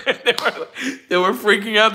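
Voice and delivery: breaking up with laughter mid-sentence; laughing. Real, not acted. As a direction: giggle while telling it. Laughing about family's reaction.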